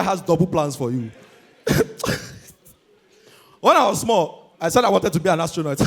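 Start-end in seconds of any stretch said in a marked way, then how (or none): none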